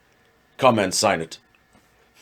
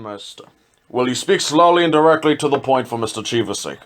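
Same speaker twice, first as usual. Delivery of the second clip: ordinary delivery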